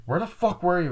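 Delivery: high-pitched demand; mock accusing. Retelling the blonde confronted after the killing, mock accusing. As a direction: demand it high, acting out suspicion.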